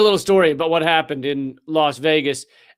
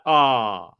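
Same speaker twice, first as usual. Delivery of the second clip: low flat ah; 7 semitones lower than usual. Shown as a demonstration. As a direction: let out a deflated "Ah".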